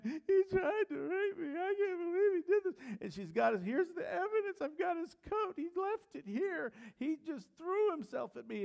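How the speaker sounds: high, loud, sobbing false accusation; fake crying wife. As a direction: wail it out like a woman putting on tears to frame him. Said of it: "And she's crying".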